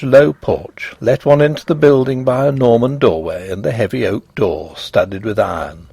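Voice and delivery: plain reading voice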